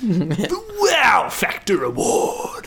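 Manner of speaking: slow dramatic award announcer. Shown as a demonstration. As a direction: boom it out like a trailer voice. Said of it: "everyone quiet for my take".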